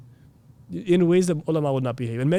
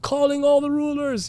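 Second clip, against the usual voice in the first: high-pitched preacher going off; ranting preacher; 11 semitones higher than usual. Acted. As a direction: parody a preacher's high, sustained call-out. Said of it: "he's just going off on everybody".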